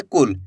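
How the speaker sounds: कुल with a brief u; short quick vowel. Shown as a demonstration. Teacher sums up the rule, कुल said quickly. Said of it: "तेजी के साथ उच्चारण करना है".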